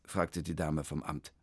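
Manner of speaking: ordinary delivery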